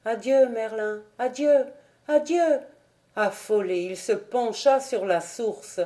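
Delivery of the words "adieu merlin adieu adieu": sobbing farewell from the water. A narrator's reading. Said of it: "un sanglot voluptueux".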